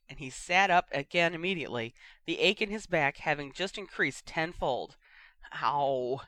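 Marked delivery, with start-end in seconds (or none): none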